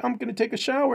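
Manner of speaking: high-pitched mock girl voice; girl's voice. Girl announcing a shower, high imitated voice. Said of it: "the chick is like".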